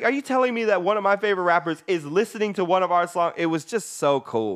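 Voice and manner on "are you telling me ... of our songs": fast, high, mock-yelled disbelief; imitated yelling. Imitating a friend thrilled a favorite rapper listens, mock yelling. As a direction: rattle it off like an astounded friend, pitched up. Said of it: "start yelling in the, in the thing".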